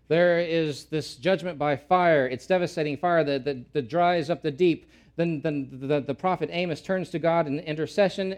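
ordinary talk

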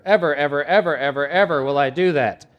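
loud, high, endlessly repeated ever; hammed-up vow. Preacher mocking Peter's endless loud vow to Jesus. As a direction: overdo the promise comically. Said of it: "three hours later".

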